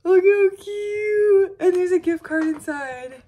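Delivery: high, slow, squealy gushing; gushing over a gift. Real, not acted. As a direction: coo over the present like it's precious. Showing a gift excitedly.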